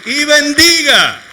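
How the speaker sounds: slow, loud, high proclaiming; preaching shout. Acted. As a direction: lift it up, slow and ringing. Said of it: "preacher commands the congregation".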